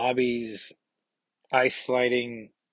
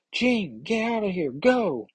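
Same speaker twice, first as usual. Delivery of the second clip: high-pitched acted shout at barely raised volume; mock shout; 9 semitones higher than usual. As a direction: call out urgently in a high, light mock yell. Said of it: "Professor Pride shouts".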